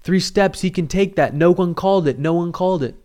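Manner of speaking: heated protest. Retold or imitated. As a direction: insist hard that no one called it. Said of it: "he's charged".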